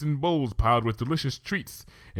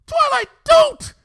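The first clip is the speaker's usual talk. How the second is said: much higher, loud, slow warning cry; shout; 11 dB louder, slower than usual. Acted. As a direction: scream to stop her. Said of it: "at the shout".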